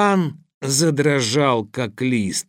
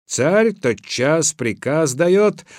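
usual speaking voice

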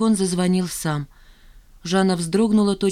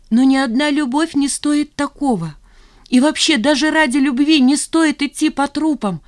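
high, loud, desperate insistence; impassioned protest; 9 semitones higher, 6 dB louder than usual. A narrator's reading. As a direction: argue at a near-cry. Woman protesting passionately to lover. (с надрывом, высоко и громко)

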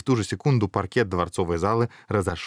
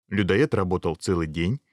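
plain reading voice

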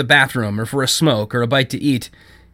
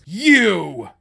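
mildly indignant cry, higher; righteous anger; 5 semitones higher than usual. A narrator's reading. Judith calls from the back of the store, righteous anger. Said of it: "it was full of righteous anger".

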